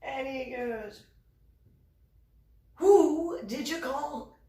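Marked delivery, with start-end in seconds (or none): 2.8-4.4 slow, high-pitched incredulous demand; mock seething anger. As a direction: imitate a seething commander, drawing it out. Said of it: "like, seething mad".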